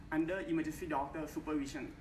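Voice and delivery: ordinary talk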